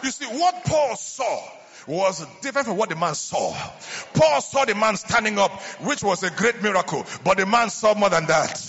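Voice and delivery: loud, high, sung-out preaching cadence; preaching at full force. Acted. Preacher stirring up the church with loud sung cadence. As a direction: build it up and holler to the congregation.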